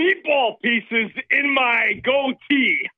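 Sebastian Gorka. Acted. Caller commits harder to Gorka parody, exaggerated fake accent. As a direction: mimic a pompous fake accent. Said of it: "somehow mimic a fake accent".

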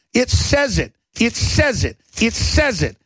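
emphatic repeated it says it; insistent. Real, not acted. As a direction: hammer home it says it. Insistent repetition.

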